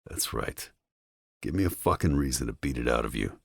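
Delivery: soft, low, fast threat; quiet menace. A narrator's reading. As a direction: press the threat close and hushed.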